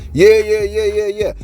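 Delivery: high, loud burst of yeahs; eager yeahs. Acted. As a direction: blurt out an eager yes, yes. Eager reply to imagined viewer.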